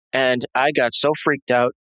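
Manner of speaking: usual speaking voice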